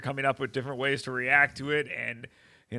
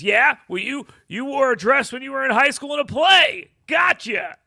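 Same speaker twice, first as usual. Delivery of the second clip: mocking; 11 semitones higher, 10 dB louder than usual. Mocking the media's retort.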